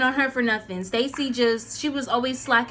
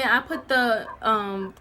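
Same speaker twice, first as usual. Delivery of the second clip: ordinary delivery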